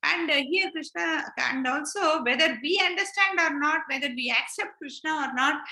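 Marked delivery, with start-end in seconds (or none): none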